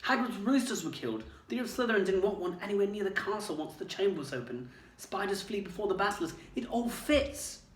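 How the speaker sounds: excited. A narrator's reading. Harry piecing it together.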